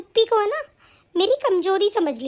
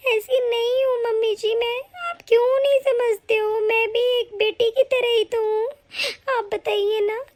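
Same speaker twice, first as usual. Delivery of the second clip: ordinary talk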